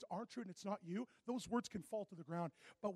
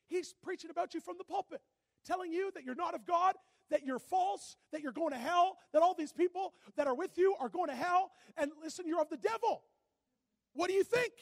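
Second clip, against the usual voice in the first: rising, louder and higher, worked up; heated retelling; 7 semitones higher, 10 dB louder than usual. Retold or imitated. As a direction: pile it on, getting hotter each line. Friend retelling pastor's attack, heated.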